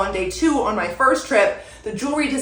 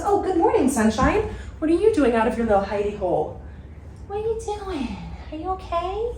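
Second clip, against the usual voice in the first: high-pitched, soft cooing; 7 semitones higher, 5 dB quieter than usual. Real, not acted. Greeting a little one out of hiding, cooing baby talk. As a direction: coo sweetly, getting higher and softer.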